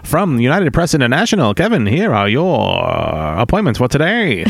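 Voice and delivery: 1940s newsreel announcer voice; old newsreel. Pretend. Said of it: "like a newsreel from the nineteen forties".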